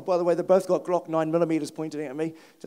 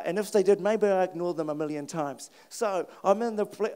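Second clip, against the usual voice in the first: ordinary delivery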